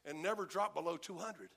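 normal talking voice

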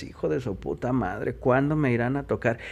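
muttered inner thought. Retold or imitated. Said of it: "tú decías".

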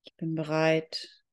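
ordinary talk, nothing marked

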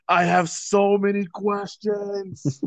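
half-speed, high-pitched bewilderment; drawn-out bewilderment. Real, not acted. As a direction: draw every word out in disbelief. Drawn-out bewilderment, then echoed.

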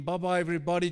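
normal talking voice

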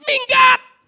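very high, very loud shout; furious yell. Retold or imitated. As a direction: drive him out like a demon. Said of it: "kayak ngusir setan". (bentakan mengusir yang sangat keras)

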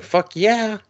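high-pitched excited acceptance; mock-eager high exclamation; eager retold yes. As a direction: jump up high on an enthusiastic yes.

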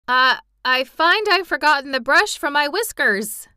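hesitate, then blurt it out nervously; trembling with fear. A narrator's reading. General excusing his flight to the Tin Woodman, fearful and flustered. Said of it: "trembling with fear".

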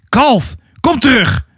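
high, carrying call. Acted. Wildo calls Atta back from danger, calling out high. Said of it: "hoorde ze roepen".

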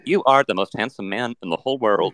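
synthetic robot voice; chatbot. Acted. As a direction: speak like a polite, literal machine. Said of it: "this is a chat bot".

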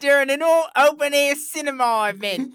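hammed-up British pomposity; pompous British reviewer. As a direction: read it as an absurdly grand British critic. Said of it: "is this a pompous British person writing this review?".

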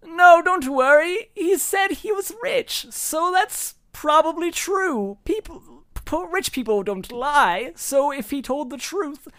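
very high, slow society lady; Cordelia reassuring. Acted. The GM voicing Cordelia explaining rich people never lie, very high. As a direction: reason it out with airheaded certainty. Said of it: "And Cordelia says".

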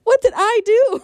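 high, plaintive what did I do; bewildered and wounded; stunned why me; hurt disbelief. Retold or imitated. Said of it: "I'm like".

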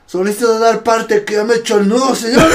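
loud, high formal military report; recruit reporting. Retold or imitated. Recruit absurdly reporting the knot is done, loud high military shout. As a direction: sound it off at parade-ground volume.

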